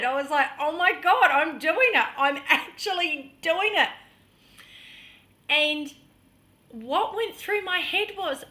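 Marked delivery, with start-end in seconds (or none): 0.6-3.9 higher, loud, amazed delight; excited. Retold or imitated. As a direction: burst out in thrilled disbelief. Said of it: "I was so excited".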